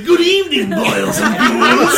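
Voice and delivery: high, slow creepy host with a laugh; Crypt Keeper voice. Retold or imitated. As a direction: ghoulish horror host greeting, drawn out. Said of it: "getting closer and closer to the Crypt Keeper".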